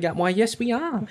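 high, mimicked American lady; American accent. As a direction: lift it into an American lady's accent. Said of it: "they were like".

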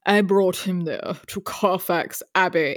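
slow, stiff mock English accent; a clumsy imitation of a bad English accent; Keanu's bad British accent. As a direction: ham up a wooden fake-British line. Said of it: "It's the one way he says this line".

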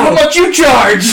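high, loud, slow leering call; hammed-up creepy dad yell; mock catcall. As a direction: holler it at a stranger on the sidewalk. Dad catcalling women, retold.

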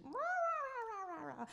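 high squawky rah rah imitating a voice through the phone; mock phone yelling. Mimicking the prophetess yelling through the phone, high squawks. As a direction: squawk it like a shouting caller on the line. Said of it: "I hear his phone just".

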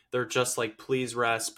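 ordinary talk, nothing marked

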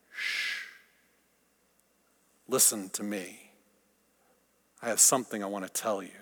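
soft, low hush; calm them gently, almost whispered. Acted. God hushing the listener.

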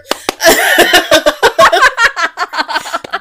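long, loud, high-pitched laughing fit; helpless laughter. Real, not acted. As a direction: burst out laughing and keep it going. Cracking up at crapper joke.

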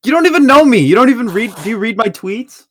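loud, high indignant protest; frustrated outburst. Real, not acted. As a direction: defend yourself, getting heated. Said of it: "because I get fucking frustrated".

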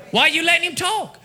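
ordinary delivery

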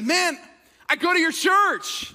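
high, eager announcement; imitated excited greeting. Churchgoer greeting his pastor in Costco, high excited voice. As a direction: act out a thrilled churchgoer.

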